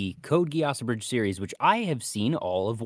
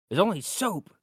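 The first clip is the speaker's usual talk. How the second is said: higher, lightly voiced confused cartoon character; lightly hammed-up cartoon voice; quoting a sketch character; 9 semitones higher than usual. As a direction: toss off the sketch line in a silly put-on voice. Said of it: "we're obviously not doing it justice".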